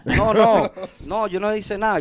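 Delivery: hammed-up innocent no no no; mock denial. [name] caught by the father, loud hammed-up denial. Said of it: "mi papá venía".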